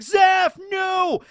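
shouted, very high anguished yell; Sanji's cry. Retold or imitated. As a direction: scream it as your mentor falls. Sanji seeing Zeph hurt.

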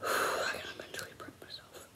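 breathy hushed whisper. Real, not acted. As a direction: whisper to yourself, bracing. Bracing before sour candy.

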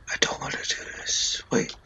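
breathless frightened whisper; scared whisper. Real, not acted. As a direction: whisper it, too scared to speak aloud. Scared gamer whispering to himself.

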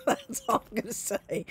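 squeaky laughing aside, high. Real, not acted. Joking to a friend about the divorce, laughing high. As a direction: laugh as you cut yourself off. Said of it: "laughing while saying it".

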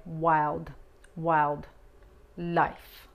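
"Wild" with a hard final D, then "life"; strong D demo. Shown as a demonstration. Teacher breaking "Wild, wild, life" into parts. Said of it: "la D es fuerte".

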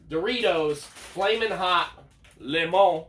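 slow, drawn-out product announcement; announcer voice. Acted. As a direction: announce it like a commercial. Announcing product name slowly.

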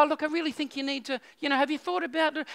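put-on soft, gentle coaxing to a child; really nice and gentle. Dad gently coaxing a stubborn kid, soft and kind. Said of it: "be really nice and really gentle".

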